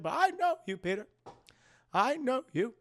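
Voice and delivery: high fond aunt voice; Aunt May voice. Retold or imitated. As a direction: coo it lovingly. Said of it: "That's my Aunt May voice".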